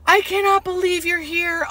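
high, thrilled patient's voice; happy disbelief. Retold or imitated. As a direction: marvel out loud at the visitor. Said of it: "so happy and so pleasant".

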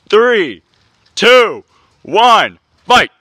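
loud, rising ringside count; announcer's countdown. Acted. Announcer starts the match, loud punched countdown. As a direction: call out each count to the crowd.

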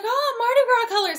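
high, loud imitation of delight; mock excited exclamation. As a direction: gush it as the other person did. Retold delighted reaction to yarn.

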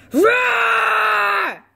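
loud high roaring shout; monster roar. Acted. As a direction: roar like a beast. Monster roar to scare chicks.

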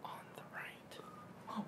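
slow, drawn-out whisper; whispering on the phone. Real, not acted. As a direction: stretch the whisper out slowly. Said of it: "Why are you whispering?".

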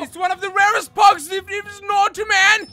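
high, loud panicked yelling; frantic shout. Real, not acted. Gamer yelling at his friend to save the pug, frantic. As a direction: yell to save it.

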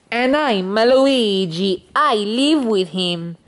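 put-on slow, drawn-out Italian accent; Luigi. Luigi introduces himself, slow Italian accent. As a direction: stretch it out in cartoon Italian. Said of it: "stop doing the Italian face voice too".

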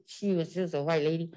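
ordinary talk

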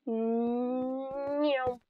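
long drawn-out mock meow; cat meow. Player idly imitating a cat, playful drawn-out meow. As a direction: meow softly, stretching it out.

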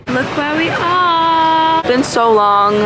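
thrilled to be back at last; excited. Real, not acted. Excited arrival at Harrods.

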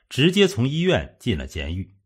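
ordinary delivery, nothing marked

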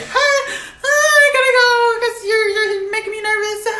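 high, drawn-out flustered squeal, half laughing; nervous squeal. Acted. A flustered crush fleeing the one they like, nervous high squeal. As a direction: squeak it out, giggling and whiny.

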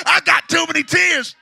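shouted line. Acted. Preacher shouting to the church over music. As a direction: stress on I, shouted high and loud.

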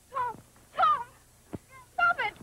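a sister shouting as the fight goes on. Acted. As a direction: yell it to stop the fight. Sister cries out louder.

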